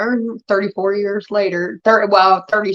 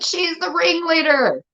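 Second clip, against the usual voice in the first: much higher and slow, pointed accusation; drawn-out knowing accusation; accusing stepmom; 9 semitones higher, slower than usual. Retold or imitated.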